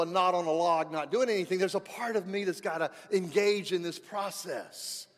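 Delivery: full-force preaching, loud and emphatic. Acted. Pastor exhorting the congregation, loud preaching. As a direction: drive the point home from the pulpit.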